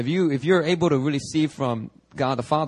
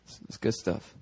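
whispered aside; 6 semitones lower, 9 dB quieter than usual. Real, not acted. Quiet aside to himself.

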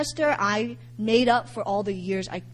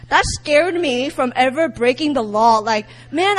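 ordinary delivery

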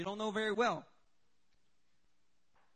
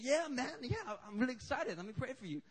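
eager; 6 semitones higher than usual. Retold or imitated. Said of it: "prayed with all this zeal".

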